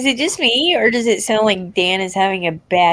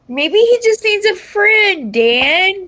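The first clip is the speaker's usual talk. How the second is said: teasing; 9 semitones higher, much slower than usual. Real, not acted. Teasing suggestion.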